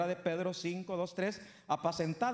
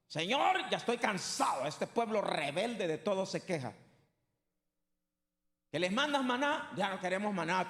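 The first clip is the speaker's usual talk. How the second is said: exasperated Moses; 2 semitones higher, 3 dB louder than usual. Retold or imitated. Moses complaining loudly to God about the people. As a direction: cry out to God, worn out by the grumbling people. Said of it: "Y le oró a Dios así".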